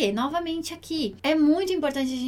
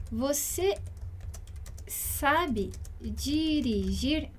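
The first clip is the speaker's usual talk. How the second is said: sabe dirigir stretched out, word by word; slow teacher repetition; very slow and drawn out; 4 dB quieter, much slower than usual. Shown as a demonstration. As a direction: drag out sabe dirigir slowly for learners. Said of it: "teacher repeats translation slowly".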